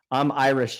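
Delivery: normal talking voice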